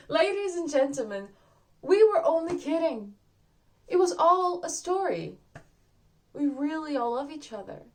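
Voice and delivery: high, loud actor addressing the house; projected, imagined curtain speech; curtain-call announcement. Pretend. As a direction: proclaim it brightly to a whole theatre.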